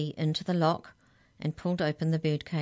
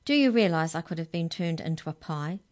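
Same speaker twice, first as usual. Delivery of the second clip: plain reading voice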